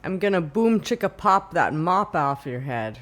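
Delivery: put-on tough-guy Sicilian accent, loud. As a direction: Sicilian mobster accent, punchy. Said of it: "a Sicilian accent".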